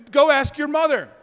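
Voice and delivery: dad passing the buck, worn down; exasperated dad. Retold or imitated. Dad to nagging kid, pushing him off to mom, exasperated.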